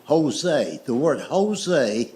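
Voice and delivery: "Jose" said ho-zay, J as H; explaining slowly, "Jose" with an H. Shown as a demonstration. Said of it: "pronounced with an H".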